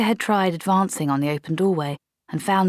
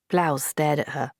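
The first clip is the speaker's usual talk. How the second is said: plain reading voice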